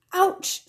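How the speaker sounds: sharp high cry of pain; a sudden yelp after the fall; pained ouch. A narrator's reading. She falls and hurts herself.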